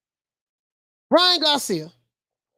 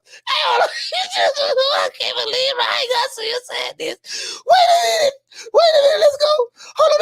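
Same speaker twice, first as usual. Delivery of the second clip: incredulous shout; 10 semitones higher than usual. Real, not acted. Vlogger reacting to Ryan's quote, high incredulous shout. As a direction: shriek it like you can't believe it. Outraged disbelief at the article.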